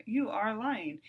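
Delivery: ordinary talk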